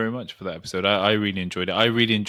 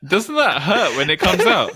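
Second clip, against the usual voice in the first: laughing; 9 semitones higher, 6 dB louder than usual. Real, not acted. Laughing at square poo.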